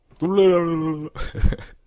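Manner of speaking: high, loud tongue-wagging "bleh-leh-leh"; silly drawn-out babble noise; flailing noise. Acted. Reader mimics him stuttering with arms flailing, silly babble.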